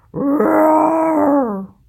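loud high hammed-up roar, in a different voice; bear roar. Bruce losing his cool at the mice, loud roar. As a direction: let out a big storybook bear roar. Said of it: "Bruce started to lose his cool".

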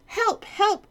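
high frantic cry for help; panicked page cry. Acted. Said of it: "cried the page".

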